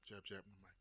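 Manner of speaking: normal talking voice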